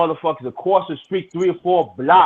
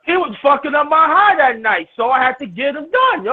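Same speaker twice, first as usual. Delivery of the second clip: heated protest; 7 semitones higher than usual. Real, not acted. Protesting to friends who tease him, raised voice.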